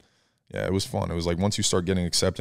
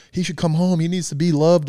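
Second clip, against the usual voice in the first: mom crying; 9 semitones higher, 4 dB louder than usual. Retold or imitated. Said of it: "My mom was, like, crying, like".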